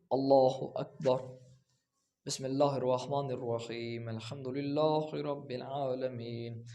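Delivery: slow Allah Akbar, then a pause of a second before بسم اللہ; slow, calm recitation with a clear break after the takbir; measured prayer opening, unhurried; right way, with a pause. Shown as a demonstration. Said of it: "تکبیرۃ الاحرام کہنے کے بعد ... وقفہ".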